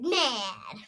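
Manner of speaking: usual speaking voice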